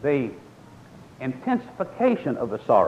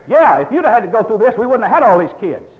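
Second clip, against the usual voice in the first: high, loud mock wife retort; 8 semitones higher, 12 dB louder than usual. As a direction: play the exasperated wife snapping back. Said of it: "she'll say".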